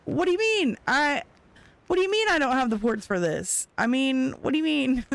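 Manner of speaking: high-pitched indignant "what do you mean", repeated; indignant disbelief. Retold or imitated. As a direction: play the outraged wife who hasn't understood. Indignant reply to husband.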